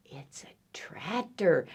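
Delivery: hushed reveal; awed whisper. Acted. Reader reveals what duck sees next, awed whisper. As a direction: whisper it in wonder.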